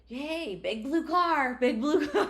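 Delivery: slow, high, loud singsong tease; teasing husband. Retold or imitated. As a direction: drag it out, mocking her about the crash. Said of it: "Ian will still to this day be like".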